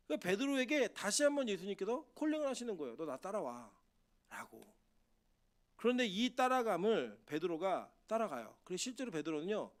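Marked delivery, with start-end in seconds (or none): 3.0-4.7 very slow, soft voice of Jesus; quiet, drawn-out call to follow. Acted. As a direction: call him gently and deliberately, as Jesus. Jesus voiced calling Peter.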